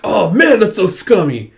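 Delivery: loud disgusted jab; disgusted outburst. Acted. Disgust at Tatsuomi's trick.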